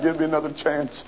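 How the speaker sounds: soft worn-out "Give me another chance."; weary quiet plea. Acted. Prisoner pleading softly after a day of crying. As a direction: let it sink, exhausted. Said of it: "shook the bars and cried".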